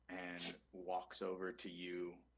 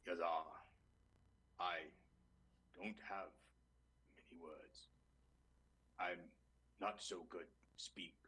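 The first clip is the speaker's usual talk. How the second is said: lightly voiced big reptile, word by word with long pauses; halting alligator mourner. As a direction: struggle haltingly through a simple farewell. Alligator of few words mourns friend.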